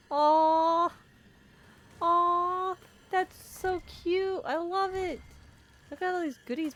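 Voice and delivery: high, loud delighted aww; cooing aw. Real, not acted. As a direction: squeal over something adorable. Cooing over cute thing.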